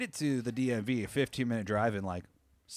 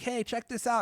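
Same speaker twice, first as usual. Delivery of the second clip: high chirpy family; 12 semitones higher than usual. Retold or imitated. Family calls him over.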